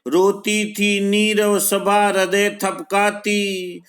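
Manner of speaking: high, sustained chant-like poetry reading; verse recitation. Acted. Teacher reciting the next stanza on Kaikeyi, high measured verse recitation.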